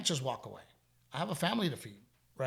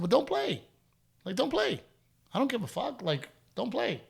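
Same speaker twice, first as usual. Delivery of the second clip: higher, fed-up retort; exasperated dad; 8 semitones higher than usual. Retold or imitated. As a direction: tell him off, half-exasperated. Dad retelling his frustrated reply.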